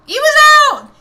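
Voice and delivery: high, loud protest; shouting. Real, not acted. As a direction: yell that he was out. Arguing call on replay.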